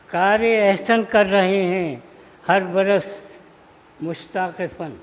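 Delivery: measured mushaira delivery, phrase by phrase; Urdu poetry recitation. Acted. Poet honouring Turfa before the gathering, measured recitation. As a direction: declaim each half-line and let it hang.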